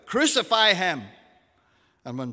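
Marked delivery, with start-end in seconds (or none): 0.1-1.0 loud, raised-pitch demand; crowd's louder shout. A narrator's reading. Crowd shouts all the louder at Pilate, raised-pitch shout. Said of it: "they shouted all the louder".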